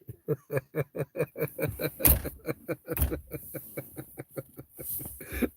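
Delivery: long high cackling laugh; high drawn-out real laugh. Real, not acted.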